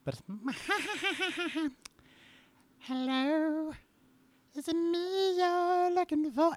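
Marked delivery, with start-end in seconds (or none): 0.3-1.9 squeaky high puppet giggle. Acted. Dad warms up into his Elmo voice with a giggle. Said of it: "I haven't done Elmo". 2.8-6.5 very high squeaky puppet voice, slow. Acted. Dad sings [name] as Elmo for fun. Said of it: "I haven't done Elmo in a long time".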